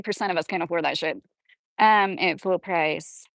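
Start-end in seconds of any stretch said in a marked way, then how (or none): none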